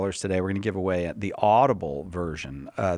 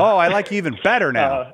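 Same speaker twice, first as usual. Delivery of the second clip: laughing; 7 semitones higher, 6 dB louder than usual. Real, not acted. Host laughs at caller's joke.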